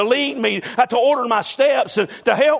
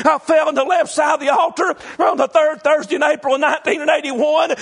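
driving, raised-pitch revival preaching; full-force preaching; 4 semitones higher than usual. Real, not acted. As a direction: preach the testimony hard, building without letup. Preaches his conversion testimony at full force.

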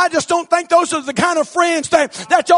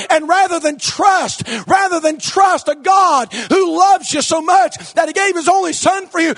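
full-force revival preaching; fiery preaching; 4 semitones higher than usual. Real, not acted. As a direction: preach it hard to the crowd.

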